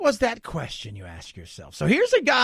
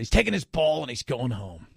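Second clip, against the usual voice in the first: normal talking voice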